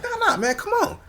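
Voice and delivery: high mock protest. Comedian urging him to come along, high mock protest.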